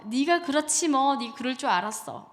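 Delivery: mock scornful put-down, starting loud; dismissive parent. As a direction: act out a parent writing the child off. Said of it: "무시하는 거야".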